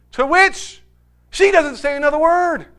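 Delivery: ordinary delivery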